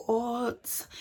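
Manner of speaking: high, drawn-out "what"; incredulous, stretched question; shocked friend. Retold or imitated. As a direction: imitate a friend's stunned reaction. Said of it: "She's like".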